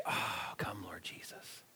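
tired elder whispering a prayer; under his breath. Retold or imitated. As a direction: breathe it out as a weary sigh. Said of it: "sigh quietly under his breath".

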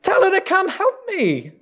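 upset, pleading high for help; upset Martha. Acted. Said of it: "She's probably upset".

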